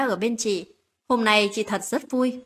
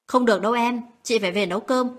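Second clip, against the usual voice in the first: ordinary delivery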